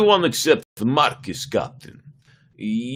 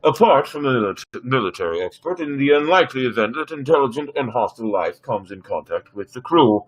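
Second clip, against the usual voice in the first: even, machine-like computer; ship computer voice; 2 semitones lower than usual. Acted. Ship computer advising the captain on specialists, machine-like. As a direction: read it like a dutiful onboard AI.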